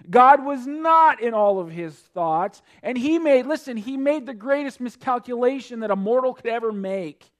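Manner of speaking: loud, high, impassioned preaching; fervent preaching. Acted. Preacher warning the congregation about the rich fool, loud and impassioned. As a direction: drive the warning home to the congregation.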